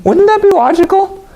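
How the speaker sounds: high incredulous question. Real, not acted. Preacher arguing with listeners, high incredulous. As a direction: push the obvious point.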